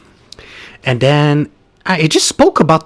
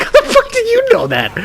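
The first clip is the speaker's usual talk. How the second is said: laughing disbelief. Retold or imitated. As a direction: squeeze it out between laughs. Quoting own shock, laughing.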